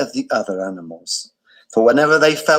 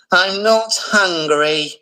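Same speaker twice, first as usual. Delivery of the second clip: slow, higher, sighed-out voice; sighing refusal; 5 semitones higher, slower than usual. A narrator's reading. Delilah snubbing Gordon with a slow sigh. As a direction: let it sag with a bored sigh. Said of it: "sighed Delilah".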